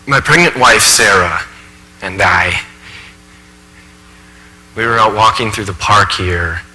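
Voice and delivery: subdued stage monologue, weighed down by unease; troubled professor confessing. Acted. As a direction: recount a frightening memory haltingly and softly. Professor haltingly recalls frightening encounter.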